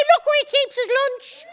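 put-on high, laughing kid's taunt; mocking taunt. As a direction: jeer like a cheeky schoolboy, laughing. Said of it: "Anto stood there pointing".

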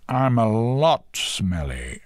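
rhino character. A narrator's reading. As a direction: stretch it out emphatically as the rhino. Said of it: "said the rhino".

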